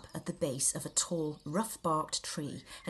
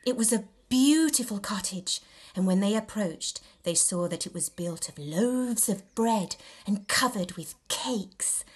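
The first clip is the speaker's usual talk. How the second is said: usual speaking voice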